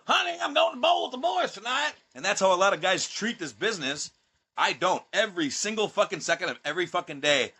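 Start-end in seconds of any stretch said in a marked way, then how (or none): 0.1-1.9 high, hammed-up voice calling to his wife; mock hobbyist husband. Mocking hobby wrestlers telling the wife they're going bowling, high hammed-up voice. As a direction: parody a weekend hobbyist heading out bowling.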